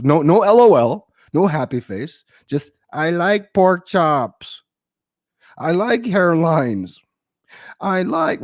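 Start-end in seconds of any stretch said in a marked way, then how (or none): 2.9-8.4 deadpan reading. Retold or imitated. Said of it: "She commented".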